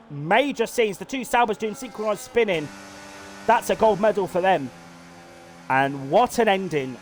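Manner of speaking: energetic motorsport commentator voice; live race commentary. Acted. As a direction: call the race lap by lap like a broadcaster.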